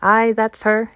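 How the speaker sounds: brisk Yorkshire answer, lightly put on; light Yorkshire accent. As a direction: housekeeper answering the station master in a touch of Yorkshire. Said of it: "speaking with a Yorkshire accent herself".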